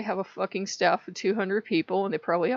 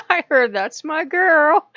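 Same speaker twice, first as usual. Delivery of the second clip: higher, louder, slow through laughter; laughing; 7 semitones higher, 10 dB louder, slower than usual. Real, not acted. Vlogger cracking up at her own rant.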